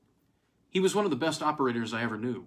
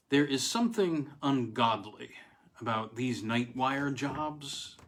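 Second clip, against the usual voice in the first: ordinary delivery, nothing marked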